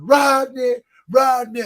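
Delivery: high, loud call across the house; yelling a name. Retold or imitated. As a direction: shout the name to summon someone. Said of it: "everybody was yelling".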